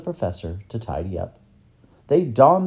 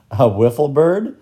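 loud, higher-pitched playful question; grinning tease; 5 semitones higher, 9 dB louder than usual. Acted. Boy teasing the professor about birds, grinning. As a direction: ask teasingly through a grin. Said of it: "asked Tom with a grin".

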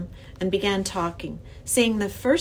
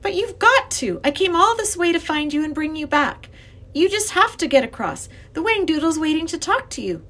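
put-on high, loud protesting child; insistent young girl; 9 semitones higher, 5 dB louder than usual. A narrator's reading. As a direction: plead insistently with the professor. Girl insists professor must cross.

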